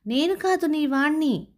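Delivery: panicked refusal. Acted. Younger brother shakes misfortune off.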